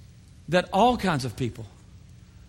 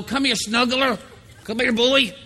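high, loud cooing; John coaxing grumpy Peter into a hug; snuggly John; 5 semitones higher, 4 dB louder than usual. Acted. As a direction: coo like a teddy-bear friend. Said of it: "He liked to snuggle".

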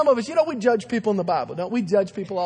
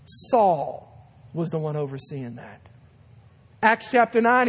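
ordinary delivery

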